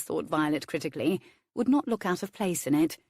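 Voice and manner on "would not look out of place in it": usual speaking voice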